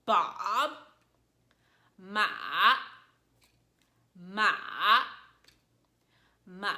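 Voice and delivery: "Bob" and "ma" dipping down then back up; warning, dipping "ma" in two pulses; third tone. Shown as a demonstration. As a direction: bend each "ma" down and up in a V. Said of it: "tone that dips down and up".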